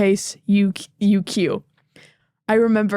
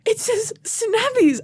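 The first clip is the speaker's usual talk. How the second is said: high, drawn-out retelling of Dad's excitement; mock excited discovery; 14 semitones higher, slower than usual. Child imitating Dad setting up his joke, mock excited. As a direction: imitate an eager "look at this", high and slow.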